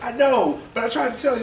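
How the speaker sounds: high, loud acted self-defence; pleading protest. Son protesting to mother.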